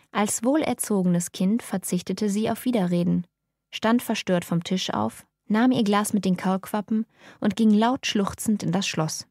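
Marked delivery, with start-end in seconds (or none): none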